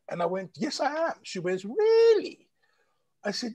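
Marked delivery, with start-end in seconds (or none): 0.6-1.1 high-pitched acted yes; defensive high reply. Telling a nosy acquaintance she's happy at home, high defensive reply. 1.6-2.5 very high, loud questioning squeal; mock incredulity. Woman doubting she's happy in South Africa, high incredulous mimicry.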